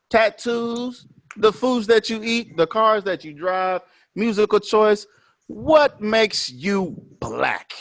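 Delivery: slow heavy final question; emphatic list. Real, not acted. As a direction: press each item home to the audience. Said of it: "What determines your level of blackness?".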